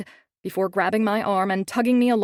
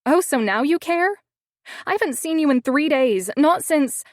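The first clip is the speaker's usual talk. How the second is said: higher, louder angry retort; indignant outburst; 7 semitones higher, 5 dB louder than usual. A narrator's reading. Heroine snapping back at William for vanishing. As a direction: throw it back at him, hurt.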